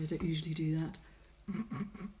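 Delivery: normal talking voice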